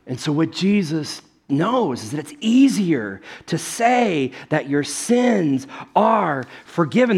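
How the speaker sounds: ordinary talk, nothing marked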